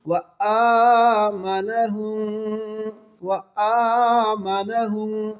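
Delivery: slow, drawn-out tajweed chant; تلاوت کا انداز; Quran recitation. Shown as a demonstration. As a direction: stretch the long vowels like a reciter. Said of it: "کھینچ کر پڑھیں گے".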